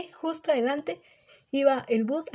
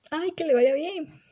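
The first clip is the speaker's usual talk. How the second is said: imitating the driver; 6 semitones higher, slower than usual. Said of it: "el chofer todavía me dice".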